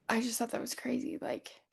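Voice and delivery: ordinary talk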